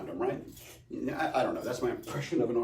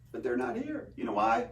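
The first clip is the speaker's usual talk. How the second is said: ordinary delivery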